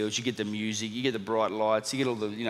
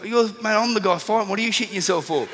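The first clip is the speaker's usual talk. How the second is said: higher, louder incredulous thought; incredulous at his terrified coach, raised and excited; exasperated inner voice; 10 semitones higher, 6 dB louder than usual. Retold or imitated. Fighter thinking at his nervous coach, higher and louder in disbelief.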